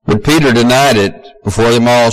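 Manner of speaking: ordinary talk, nothing marked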